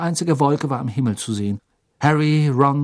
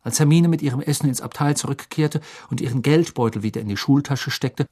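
ordinary delivery

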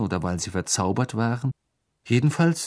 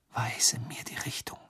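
unvoiced whisper to the wand; 11 dB quieter than usual. A narrator's reading. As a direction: breathe it, no voice at all. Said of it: "flüsterte er".

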